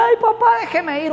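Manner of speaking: very high, pleading mock cry; imitated whining child. Son begging father to let him go, mock crying. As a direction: whine like a child begging dad. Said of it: "cuando el hijo le llora".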